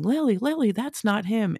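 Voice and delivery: high-pitched mock yell, little added volume; retold yelling. Army liaison calling after Lily as she runs, retold yell. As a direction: call out high after someone, imitating a yell. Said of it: "was yelling".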